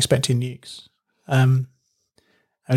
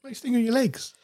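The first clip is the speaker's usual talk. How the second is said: teasing high voice; 11 semitones higher, 6 dB quieter than usual. Pretend. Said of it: "They sting me on my legs".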